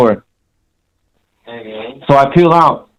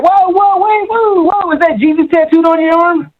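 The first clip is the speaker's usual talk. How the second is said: very high-pitched mock exclamation; excited stranger. Retells stranger calling out at him.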